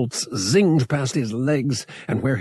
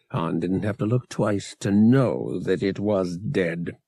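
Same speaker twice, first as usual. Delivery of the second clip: usual speaking voice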